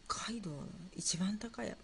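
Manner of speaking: hushed, thinking aloud; whispered pondering. Real, not acted. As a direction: whisper it to yourself. Repeats quiz question to self.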